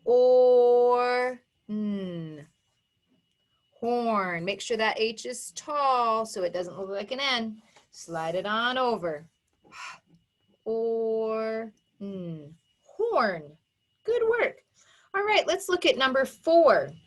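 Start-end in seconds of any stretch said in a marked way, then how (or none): 0.0-2.5 long level OR, then a hummed N; segmented phonics demo of "or n"; sounded out. Shown as a demonstration. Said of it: "Let's write it". 10.6-12.6 long level OR, then a hummed N; segmented phonics demo of "or n"; sounded out. Shown as a demonstration. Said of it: "Let's write it".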